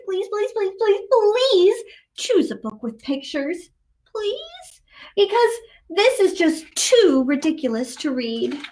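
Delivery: ordinary delivery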